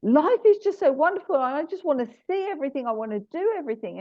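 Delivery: high, rushed, breathless-with-joy dog voice; bouncy and lovely. Retold or imitated. Dog telling the communicator how she feels, bouncy. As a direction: tumble out the words like a bouncy dog. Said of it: "she was just so bouncy and lovely".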